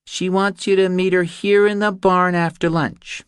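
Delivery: put-on a small girl carefully reciting a memorised message; reciting little girl. Ellie delivers her mother's message to Reacher, high and careful.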